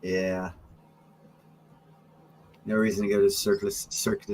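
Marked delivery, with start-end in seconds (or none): 3.7-4.2 mock high pitch. Real, not acted. Mocking makeup with Cirque du Soleil.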